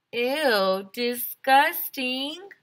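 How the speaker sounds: high, loud, slow disgusted ew; grossed out. Acted. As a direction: stretch out the disgust. Kid reacting to gross joke.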